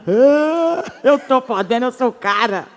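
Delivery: strut like a husband who feels like Tarzan; mock macho husband boasting with a laugh; proud Tarzan husband. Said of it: "Se sente um Tarzan".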